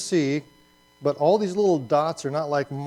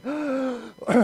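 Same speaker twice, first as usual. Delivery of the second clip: sharp gasp; 11 semitones higher, 4 dB louder than usual. Retold or imitated. Imagining looking over the cliff.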